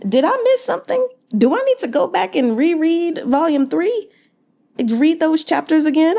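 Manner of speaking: squeaky exasperated confusion; baffled disbelief. Real, not acted. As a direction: act stunned you might have missed it. Mock-confused rant at the book.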